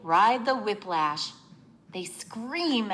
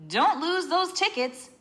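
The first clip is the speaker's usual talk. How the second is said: higher, lightly voiced girl; a worried friend's gentle warning; voicing Trish; 8 semitones higher than usual. Trish reminds Faye not to lose tickets, lightly voiced. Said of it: "she's really saying".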